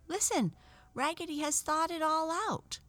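high, eager doll voices; excited little dolls. Acted. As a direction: pass the news on like excited toys. The other dolls whisper excitedly.

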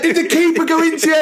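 fast, high, anxious; worried question. Retold or imitated. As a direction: rush the concern out. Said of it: "I was like".

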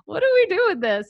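high, loud mock bafflement; bumbling incompetence. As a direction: act hopelessly clueless for a kid. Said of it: "like, incompetent".

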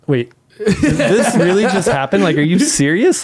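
high, half-laughing wait-what; can't believe my luck; amazed are-you-serious; stunned disbelief. Retold or imitated. Retelling shock at job offer.

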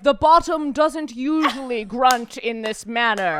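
slow nature-documentary narrator impression; David Attenborough. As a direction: narrate it slowly like a wildlife film. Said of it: "David Attenborough is like".

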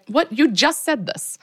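high, slow mock exasperation; incredulous. Podcast host reacting to the heroine's blunder, incredulous.

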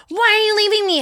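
very high imitation of a clingy friend; mock whining. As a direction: wail it like an abandoned drunk. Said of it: "biggest pet peeves in the entire world is whiny tone".

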